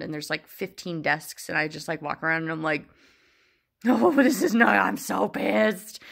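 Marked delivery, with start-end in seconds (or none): 3.9-6.0 loud, slow, exaggerated fuming; hamming up the fury at an empty building; mock angry outburst. Alone at the closed lab, a loud mock-furious outburst.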